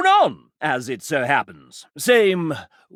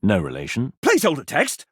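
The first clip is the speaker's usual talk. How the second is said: normal talking voice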